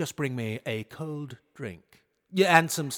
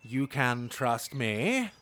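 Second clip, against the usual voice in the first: ordinary talk, nothing marked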